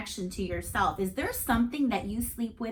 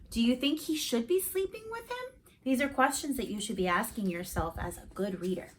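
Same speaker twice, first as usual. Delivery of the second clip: ordinary delivery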